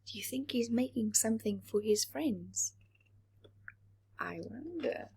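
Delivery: put-on soft whispered question to young listeners; hushed aside. A narrator's reading. Storyteller whispering to the children, hushed aside. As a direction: lean in and whisper the secret.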